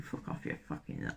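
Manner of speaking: mouthed curse, whispered and held back, no voice; whispered angry swearing. Retold or imitated. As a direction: whisper the angry part's swearing under your breath. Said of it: "with the angry people".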